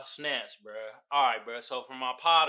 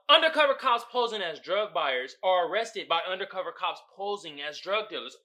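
ordinary talk, nothing marked

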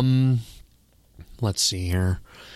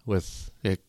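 normal talking voice

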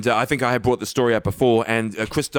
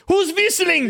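high-pitched outraged German actor; hammed-up German-accented outburst; angry German accent; slower than usual. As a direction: demand it in a mock German accent. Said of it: "especially in a German accent".

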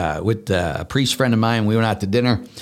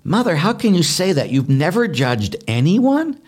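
higher, disbelieving question; astonished; 8 semitones higher, 3 dB louder than usual. Retold or imitated. Priest voicing sisters questioning Mother Teresa, astonished. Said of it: "and they says".

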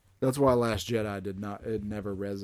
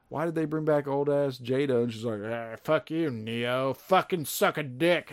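higher, louder, hammed-up furious rant; mock angry Jada; 5 semitones higher, 5 dB louder than usual. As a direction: spit it out like a bitter old rival. Voicing Jada angrily.

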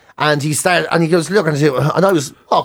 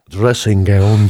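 slow, low deadpan read of a search term; drawn-out mock voice of a man typing; mock typed search; 9 semitones lower, much slower than usual. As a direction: drag the words out, low and dry. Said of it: "Types in".

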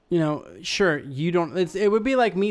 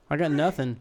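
ordinary talk

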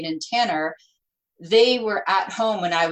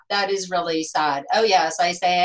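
normal talking voice

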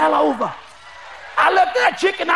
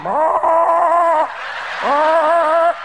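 long trilled chicken noise; imitated chickens; 3 semitones higher, 3 dB louder than usual. Other chickens watching the chopping block, nervous trilling. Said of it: "Them other chickens going".

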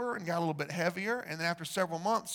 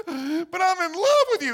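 much higher, louder, wailing plea; hammed-up pleading; 11 semitones higher, 9 dB louder than usual. Mother pleading with her ex-boyfriend, wailing high and loud. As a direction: wail it like a lovesick woman.

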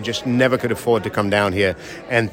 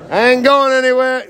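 defiant shout; 14 semitones higher, 6 dB louder than usual. Real, not acted. Defiant promise to Florida crowd.

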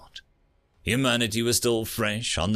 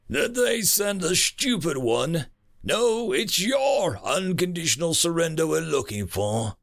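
mocking alien; 6 semitones higher than usual. Aliens mock the ambassador.

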